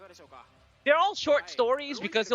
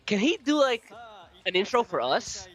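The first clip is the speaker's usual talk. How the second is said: ordinary delivery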